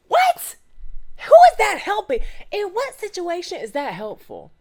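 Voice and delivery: high, loud disbelieving outburst; outraged. Real, not acted. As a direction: blurt it out in shocked outrage. Outraged reaction to the quote.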